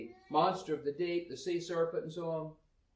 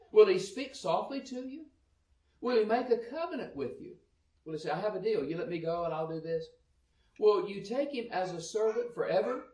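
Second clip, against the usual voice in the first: raised, higher Bible reading voice; scripture reading; 6 semitones higher, 6 dB louder than usual. Acted. Preacher reading Job to the congregation, raised and declaiming. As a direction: read the verses out with force.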